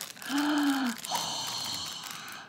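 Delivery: long gasp of delight; drawn-out gasp at something lovely; awed gasp. Real, not acted. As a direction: draw in a slow, amazed breath. Awed reaction to gift.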